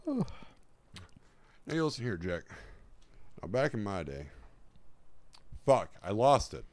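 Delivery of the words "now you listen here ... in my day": lightly voiced old president rambling; a hint of an elderly politician; Joe Biden impression. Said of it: "Joe Biden's head".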